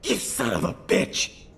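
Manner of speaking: high, spat-out curse; angry outburst. Acted. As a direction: lash out in fury. Son curses the captain arresting him.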